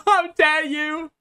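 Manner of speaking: high mock indignation, laughing; giggling through a mock 'how dare you'; laughing mock outrage. Mocking a critic's angry statement.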